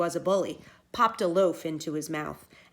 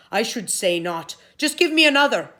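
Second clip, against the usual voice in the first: louder, higher blustering demand; blustering giant; 4 semitones higher, 9 dB louder than usual. Acted. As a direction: prove he is tough. Giant boasting.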